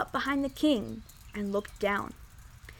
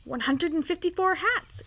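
higher-pitched, uneasy count; gulped; 6 semitones higher than usual. A narrator's reading. Counting lord stunned by the pile of hats, gulping. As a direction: say it with a nervous gulp. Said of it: "gulped Sir Alaric".

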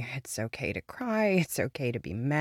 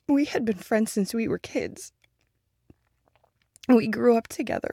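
louder, higher, fighting back tears; grieving, much louder; loud grief; 5 semitones higher, 8 dB louder than usual. Acted. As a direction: push the memories out loud. Grief outburst about a dead friend.

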